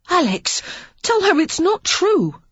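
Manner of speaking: put-on a horrified boy begging his brother; appalled boy Henry. A narrator's reading. Young Henry begging Alex, high and appalled.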